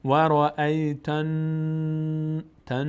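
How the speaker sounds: slow chant, long nasal n ending "تن"; nasal hold. Shown as a demonstration. Teacher demonstrating ghunnah, final n held in the nose. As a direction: hum and hold the n of "تن".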